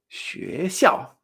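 学 rising, 校 falling sharply; second-fourth tone pattern. Shown as a demonstration. Teacher sums up the pattern, 学 up and 校 down. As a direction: climb through 学, then drop 校. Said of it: "entonación dos cuatro".

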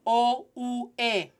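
O, U, E as clean "oh, oo, eh"; pure Spanish vowels. Shown as a demonstration. Teacher asking learners to repeat, pure Spanish vowels. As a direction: model O, U, E for repeating. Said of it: "Repeat after me".

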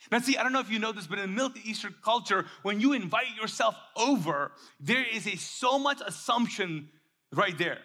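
ordinary talk

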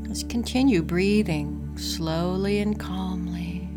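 calm, unhurried breathing guide; guided meditation. Acted.

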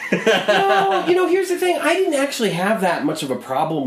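high, loud denial through laughter; squealed amused objection; laughing protest. Real, not acted. Laughing denial of teasing about contempt.

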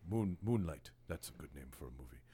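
put-on a drunk man's lazy Connery, half-hearted; poor Sean Connery. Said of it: "That's a poor Sean Connery".

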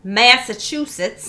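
slow, drawn-out mock Massachusetts accent; a bad hammed-up Boston accent. To a friend, a terrible mock Massachusetts accent. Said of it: "That was a terrible Massachusetts accent".

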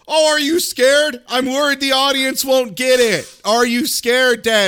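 high, loud, heavy-handed line reading; mock nagging daughter. Mocking the daughter who states everything, loud and high. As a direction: hammer the obvious, loud and high. Mocks daughter stating the obvious loudly.

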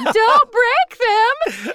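high, slow, alarmed cry; panicked plea. Retold or imitated. Owners watching the dogs get thrown, alarmed and high. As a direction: clutch your pearls and beg.

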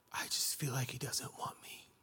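breathless hushed confession; whisper. Retold or imitated. Man admitting his hurt to his friend Dave, whispered. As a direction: whisper it like a painful admission. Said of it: "I go".

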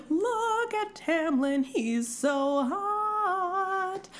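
high, drawn-out lovestruck gush; swooning bride. Acted. Bride gushing over her groom at the altar, swooning. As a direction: sing out the swoon, high and stretched. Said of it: "and she's like".

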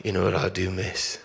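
put-on half-hearted English accent, with a laugh; English accent attempt. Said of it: "He said it with the accent".

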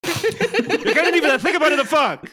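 laughing mock Italian priest caller; hammed-up Italian accent. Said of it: "do the accent as offensive as possible".

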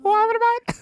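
very high squeaky parody; squeaky mimic voice. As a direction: squeak it like a cartoon. Mimicking again.

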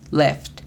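"left" in English, short e and crisp final ft. Shown as a demonstration. Teacher models "left" for learners, English pronunciation. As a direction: say "left" as one English syllable. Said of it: "La pronunciación fonética es simplemente L E F T".

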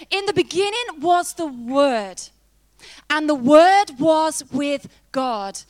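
slow, weighty Bible reading; scripture reading. Acted. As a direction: lay each phrase down slowly and solemnly. Reads scripture aloud.

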